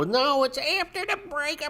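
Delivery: high, far-off whiny excuse voice; mocking chart painter. Mocking a chart painter's excuse to viewers, high and whiny. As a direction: parody a trader dodging the question.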